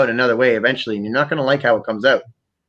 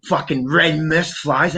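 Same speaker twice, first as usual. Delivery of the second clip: usual speaking voice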